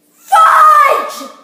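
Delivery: high, very loud frustrated yell; frustrated shout. Real, not acted. Kid after dropping the strudel, very loud frustrated shout. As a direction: curse the mishap out loud.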